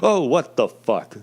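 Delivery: retold freak-out. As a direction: act out being jolted awake, stretching the words. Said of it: "I'm freaking the fuck out".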